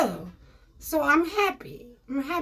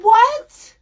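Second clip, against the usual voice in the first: incredulous; 6 semitones higher, 8 dB louder, slower than usual. Retold or imitated. Incredulous outburst.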